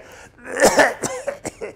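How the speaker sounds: a rising chesty cough; wet cough. Shown as a demonstration. Sick patient coughing in a hospital bed. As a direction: hack it out from sick lungs. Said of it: "he had pneumonia".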